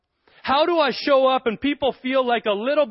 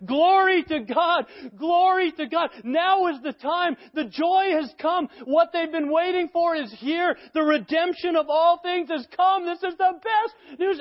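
ecstatic angels; 9 semitones higher than usual. Pretend. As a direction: let the angels burst out in celebration. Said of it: "dancing and going for it".

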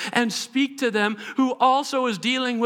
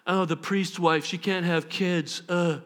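mock gossip; 6 semitones lower, 4 dB quieter than usual. Voices gossips pitying the barren wife.

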